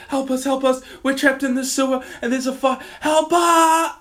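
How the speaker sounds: high, loud, desperate plea; terrified scream for help. Retold or imitated. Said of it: "all I hear is like".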